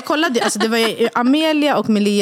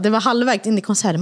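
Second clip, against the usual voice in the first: ordinary talk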